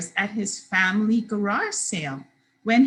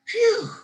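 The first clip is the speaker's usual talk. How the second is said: high, breathy "phew" of relief; sigh of relief; 13 semitones higher, 3 dB louder than usual. A narrator's reading. Brandon after a close call, sighing with relief. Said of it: "breathed out a sigh of relief".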